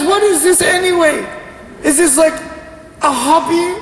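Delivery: high-pitched, puzzled mom voice; mock a mom trying to understand; baffled mother. Acted mother interrogates her son.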